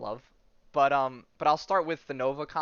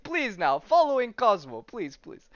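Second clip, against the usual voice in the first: put-on lightly Russian-accented pleading dog; hint of Russian accent, coaxing; 7 semitones higher, 5 dB louder than usual. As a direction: urge them along with a touch of Russian accent. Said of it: "it's in a Russian accent".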